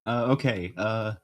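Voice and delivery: usual speaking voice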